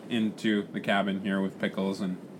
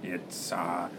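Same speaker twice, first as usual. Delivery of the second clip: pirate captain; much slower than usual. Acted. Captain finds the parrot's food, pirate voice. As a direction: exclaim the find, then settle into a growly drawl.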